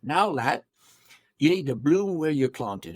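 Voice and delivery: imitated English accent, broad "ah" in planted; English-accented fatherly advice. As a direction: play a pipe-smoking English counselor. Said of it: "he had an English accent".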